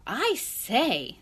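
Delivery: ordinary delivery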